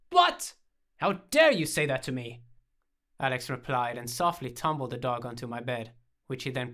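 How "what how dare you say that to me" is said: loud, high gasp of offence; hammed-up indignation; mock outrage. A narrator's reading. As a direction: act scandalised at a dog. Mock outrage at the dog's bark.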